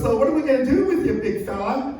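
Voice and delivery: high, quick put-on stylist voice; imitated hair stylist. Stylist asking his client about the haircut, high imitated voice. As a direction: play a chatty stylist, pitched well up.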